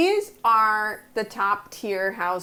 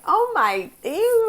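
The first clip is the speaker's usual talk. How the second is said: higher, louder mock disgust; 10 semitones higher, 3 dB louder, slower than usual. Housewives reacting to the stable, mock disgusted. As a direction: squeal a prissy ew. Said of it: "would have been like".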